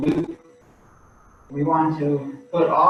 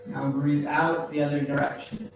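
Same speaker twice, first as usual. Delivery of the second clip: gently pace the class's breaths, quieter; guided breathing cues; 9 dB quieter than usual. Acted. Instructor pacing the class's breaths, soft and calm.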